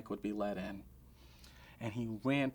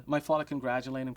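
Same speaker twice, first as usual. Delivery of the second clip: ordinary talk, nothing marked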